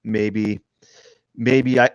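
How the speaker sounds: ordinary talk, nothing marked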